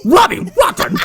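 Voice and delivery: high, loud cartoon villain call; shouted name. Friends riffing on a movie villain, loud high call. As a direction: yell it like a cartoon announcer.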